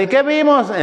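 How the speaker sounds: high, playful teacher's question; teasing quiz. Pretend. Preacher jokingly quizzing the congregation next week, pitched high. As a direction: tease the congregation with a pop quiz. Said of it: "La semana que entra les voy a decir".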